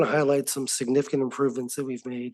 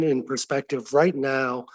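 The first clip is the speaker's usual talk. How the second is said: usual speaking voice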